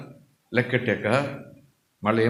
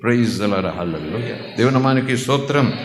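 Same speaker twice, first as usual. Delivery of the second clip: normal talking voice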